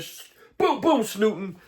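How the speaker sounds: high boom boom, answering fire; mock gunshots from across the yard; gunshot sounds. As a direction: fire back with your mouth. Imitating the neighbour's gunshots.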